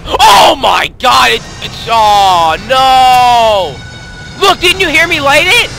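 loud panicked shout; frightened shouting. Real, not acted. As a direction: cry out in real fright. Another scare in horror game.

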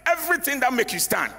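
fervent sermon exhortation; preaching. Acted. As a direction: preach it hard at the congregation.

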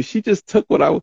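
real welling-up emotion, rushed and high; near tears. Real, not acted. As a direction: let the pitch climb as emotion takes over. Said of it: "my voice start going up".

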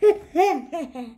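a high burst of laughter; mocking laugh. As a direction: crack up at your own joke. Petey laughing.

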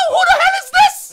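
high, loud rising yell; incredulous shout. Real, not acted. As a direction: shout it in total disbelief. Gamer shocked by opponent player.

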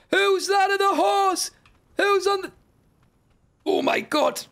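high-pitched amazed shouting; astonished cry. Real, not acted. Player spotting somebody on a horse, astonished cry. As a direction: cry out in sheer disbelief. Rider appears to rescue player.

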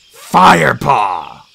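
loud, drawn-out growl of a name. A narrator's reading. Whitestorm challenges the young apprentice, loud growl. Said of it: "growled Whitestorm".

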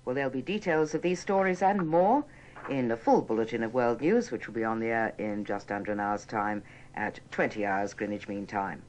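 normal talking voice

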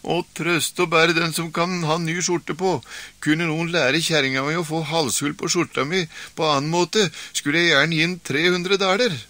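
wailing complaint. Acted. Beaten husband laments to the stranger, mock wailing. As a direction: wail about the shirt, hammed up. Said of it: "skrek og bar seg ille".

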